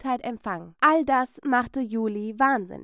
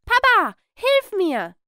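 much higher, louder wail; pinned girl shrieking for her father; cry for help; 10 semitones higher, 6 dB louder, slower than usual. Acted. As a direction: scream for her dad.

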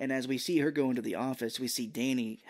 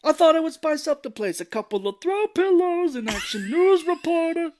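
very high, loud hammed-up rant; sarcastic mock outburst; 10 dB louder than usual. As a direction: yell the sarcasm in cartoon exasperation. Raphael's sarcastic retort, acted.

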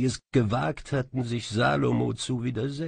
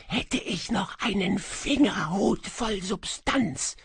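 high, ghostly, sighing echo voice; a lost spirit murmuring its lament from a kettle; high wistful whispery phantom; faint melancholy echo; 9 semitones higher than usual. A narrator's reading. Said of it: "raunte sie".

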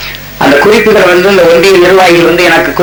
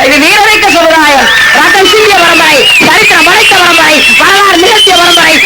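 thunderous political oratory; 7 semitones higher than usual. Real, not acted. Rousing praise of the community.

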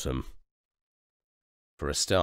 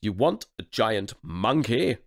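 raging duke; 6 semitones higher, 5 dB louder than usual. Acted. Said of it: "the duke raged".

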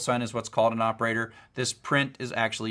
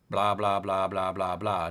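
lower, lightly voiced droning blah; mock what a bored dog hears, trailing off; dog hearing blah; 4 semitones lower than usual. A teacher mimics what a dog hears, in a low droning blah. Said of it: "the dog is hearing is like".

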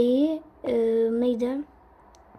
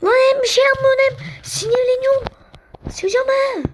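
very high, loud, fast rant; loud rapid frustrated yelling; angry shout; 11 semitones higher, 5 dB louder than usual. Real, not acted. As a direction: blow up at the idle teammate. Teammate doing nothing, raging.

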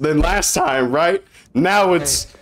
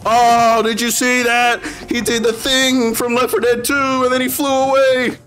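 mock amazement; 6 semitones higher than usual. Streamer pointing out a copied game move, mock amazement. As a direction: gush in a high, put-on awed voice. Mock thrilled gushing at trailer.

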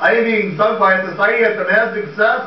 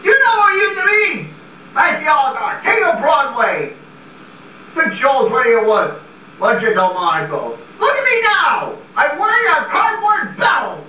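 theatrical outburst; 8 semitones higher than usual. Acted. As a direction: bellow your lost glory to the room. Max laments his fallen glory.

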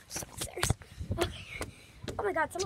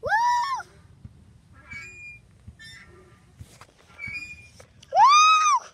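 high, loud joyful cheering; excited whoops; 10 dB louder than usual. Real, not acted. Kid on the swing cheers, high and loud. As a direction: shout for joy from the swing.

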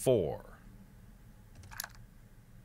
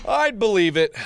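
higher, louder exclamation; emphatic concession; 7 semitones higher, 5 dB louder than usual. Real, not acted. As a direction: call it out emphatically. Repeating the line to self.

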